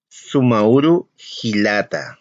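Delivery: "sumauru hilata" spoken slowly and evenly; slow Aymara greeting. Shown as a demonstration. Teacher models the brother greeting slowly. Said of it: "que sería".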